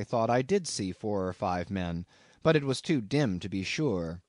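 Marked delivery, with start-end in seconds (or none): none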